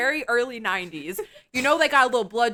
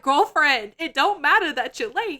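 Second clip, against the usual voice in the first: higher, louder sassy call-out; sassy warning; 6 semitones higher, 4 dB louder than usual. Retold or imitated. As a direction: warn her like a bossy friend. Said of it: "And it's like".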